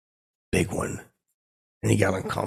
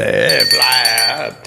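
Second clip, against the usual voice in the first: Jackie Sparrow; 2 semitones lower, 6 dB louder, much slower than usual. Retold or imitated. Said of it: "as Jackie Sparrow would say".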